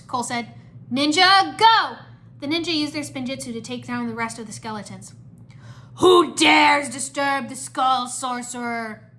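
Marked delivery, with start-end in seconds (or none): 0.9-2.0 high, loud rallying shout; battle cry. A narrator's reading. Cole leads the ninja attack, rallying battle cry. 6.0-9.1 loud, slow villain roar; shouting Skull Sorcerer. A narrator's reading. Skull Sorcerer confronts the intruders, menacing shout. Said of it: "the Skull Sorcerer shouted".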